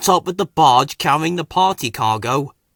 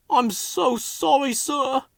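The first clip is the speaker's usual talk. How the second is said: sniffing apology; 11 semitones higher, slower than usual. A narrator's reading. Freddy apologizing to the controller, high and tearful. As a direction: say it close to tears. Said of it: "he sniffed".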